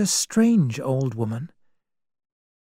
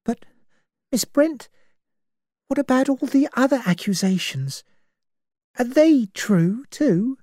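pitched up, hesitant question; nervous; 10 semitones higher than usual. Acted. Vera nervously questions Miss Brent. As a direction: ask timidly, unsure of the answer. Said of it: "Vera said nervously".

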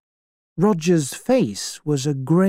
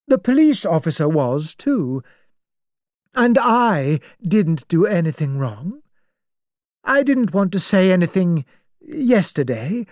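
put-on pitched-up, prim old spinster; a righteous spinster passing judgment; self-righteous old lady; 5 semitones higher than usual. Emily lectures Vera on crime and punishment.

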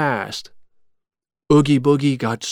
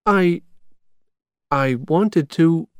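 higher, slow and halting; hesitate, then draw the words out slowly; lightly voiced hesitant young heroine; 5 semitones higher, slower than usual. A narrator's reading. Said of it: "she said slowly".

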